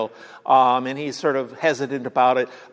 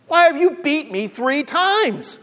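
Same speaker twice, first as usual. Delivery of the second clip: much higher hammed-up donkey voice; talking donkey; 11 semitones higher than usual. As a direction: plead like a wronged donkey, pitched way up. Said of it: "the donkey turns to him and says".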